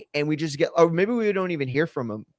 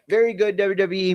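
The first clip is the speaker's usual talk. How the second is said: ordinary delivery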